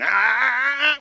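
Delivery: sharp high ah to stop the dog; correction sound. Shown as a demonstration. Trainer correcting dog jumping on counter, sharp high ah. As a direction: bark out a quick high ah to correct. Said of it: "got this new condition".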